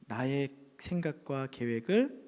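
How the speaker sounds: usual speaking voice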